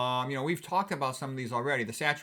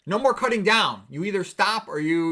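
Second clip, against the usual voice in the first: much louder and higher, firm; loud insistence; 5 semitones higher, 7 dB louder than usual. Retold or imitated. Doctor insisting to a patient, much louder. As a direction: lay down the law.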